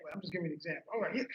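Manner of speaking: usual speaking voice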